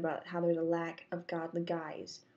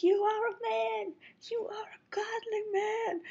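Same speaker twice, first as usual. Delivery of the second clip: high-pitched, drawn-out mock shout; reenacted yell; 14 semitones higher than usual. Affirming a man close to his face, high mock yell. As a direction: imitate screaming without fully screaming, pitched way up. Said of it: "yelling at him".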